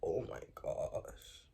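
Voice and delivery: awed whisper. Retold or imitated. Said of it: "I'm like".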